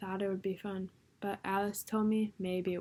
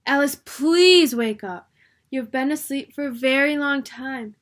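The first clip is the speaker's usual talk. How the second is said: calling out; 6 semitones higher, 12 dB louder than usual. A narrator's reading. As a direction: call her to wake up. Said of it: "someone calling her".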